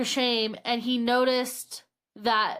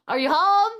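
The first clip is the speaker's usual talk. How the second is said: louder, higher questioning call; tentative calling out; 7 semitones higher, 4 dB louder than usual. Retold or imitated. Man checking on old neighbor from the door, calling out. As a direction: call into the house to check on him.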